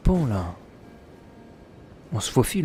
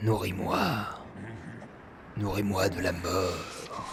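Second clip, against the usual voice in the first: translating the creature; 5 semitones lower, 13 dB quieter than usual. Retold or imitated. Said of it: "I smell it".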